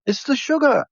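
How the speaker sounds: a hint of the man explaining the sugar; imitating local man. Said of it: "He goes".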